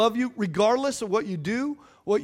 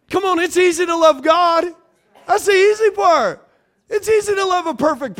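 higher, louder preacher push; preaching lifts with conviction; rising fervor; 7 semitones higher, 5 dB louder than usual. Real, not acted. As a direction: build it up with fervor. Excited preaching.

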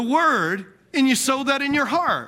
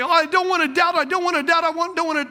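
high, whining mock of a doubting believer; whiny doubter; 6 semitones higher, faster than usual. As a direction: pitch it up and whine like someone fighting doubt. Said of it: "A lot of times people say".

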